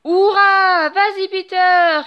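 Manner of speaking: loud, high cheering shout; children cheering their hero. A narrator's reading. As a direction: cheer him on like a crowd of kids. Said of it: "crient les enfants".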